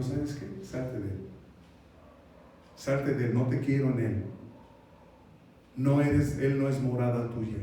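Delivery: low, controlled command; Jesús, calm authority. Acted. Jesus expelling demons from the man, calm. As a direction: order it out without raising the voice. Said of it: "Pero con calma".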